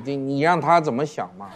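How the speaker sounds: ordinary delivery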